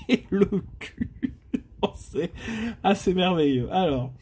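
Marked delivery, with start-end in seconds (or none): 0.0-2.3 slow, laughing through the letters. Real, not acted. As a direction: laugh at the bad draw while reading. Reads bad letters, laughing. (lettres lues en riant)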